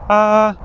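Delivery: "ah" held level and smooth, high in the range; first tone ah. Shown as a demonstration. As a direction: sing "ah" flat and even at the top of your voice. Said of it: "a consistent, smooth".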